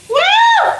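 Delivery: very high shouted whoop; gasping woo. Real, not acted. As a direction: blow out the stink. Exhaling after stench.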